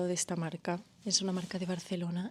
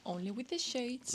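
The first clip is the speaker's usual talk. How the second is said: higher, softer meme quote; 4 semitones higher, 5 dB quieter than usual. Acted. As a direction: playfully mimic a meme, pitched up. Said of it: "es un meme de [name]".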